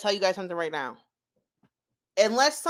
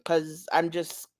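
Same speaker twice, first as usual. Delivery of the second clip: ordinary talk, nothing marked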